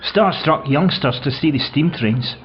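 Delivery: ordinary talk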